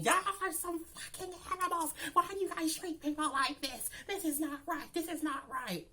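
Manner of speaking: high-pitched imitation of tearful outrage; mock boohooing. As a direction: wail the protest like a drama queen. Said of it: "She done started boohooing, crying again".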